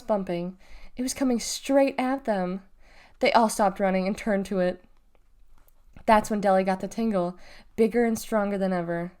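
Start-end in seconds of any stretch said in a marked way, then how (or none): none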